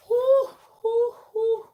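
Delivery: high breathy whews after a fright; high relieved whew after a scare; shaky high whew of relief. Real, not acted. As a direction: blow out the shock in high gasps. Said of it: "Oh my God".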